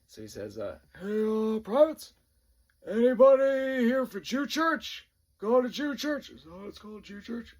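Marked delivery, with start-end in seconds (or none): 1.0-6.3 loud, high bellow with drawn-out words; drill sergeant yelling. Retold or imitated. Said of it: "Like, yelling". 6.3-7.5 high, soft, unsure question; hushed aside. Retold or imitated. Drill sergeant unsure what the service is called, hushed aside.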